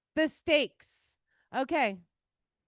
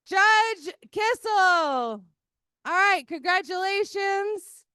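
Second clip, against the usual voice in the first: slow, louder winner announcement; raffle caller; 5 semitones higher, 6 dB louder, slower than usual. Real, not acted.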